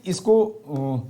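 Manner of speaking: ordinary talk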